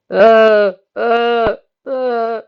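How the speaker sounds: high, loud panicked uh cries; panicked screaming. Acted. Colt screaming as Nick grabs him, panicked cries. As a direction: shriek in panic. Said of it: "he started screaming".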